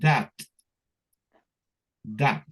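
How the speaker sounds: That said crisply; that demo. Shown as a demonstration. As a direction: model That for the class. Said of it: "Así, miren".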